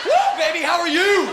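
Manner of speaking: high, loud holler; whooping greeting. Pretend. Comic greeting the crowd, whooping high.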